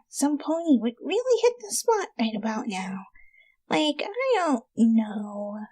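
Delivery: soft, breathy whisper of craving; hungry whisper. Acted. Rainbow confesses to friends she craves pony meat. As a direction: whisper it like a guilty secret.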